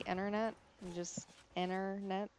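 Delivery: "internet" as "innernet", no T; dropped T. Shown as a demonstration. Vlogger showing how Michiganders say it, dropped T. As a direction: say "internet" with the first T left out. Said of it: "don't like our T's".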